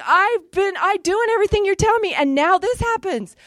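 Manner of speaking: hammed-up hurt protest; complaining to God. Still arguing with God, high aggrieved whine. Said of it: "And I was like".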